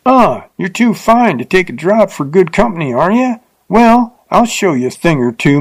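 put-on a drunk rider turning on her; drunken fierce. A narrator's reading. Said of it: "he turned suddenly fierce".

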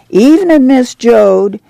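a lightly voiced camp neighbor calling Evenin' to Ma; neighbor's greeting. A narrator's reading. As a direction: call out Evenin' cheerily to a passing neighbor.

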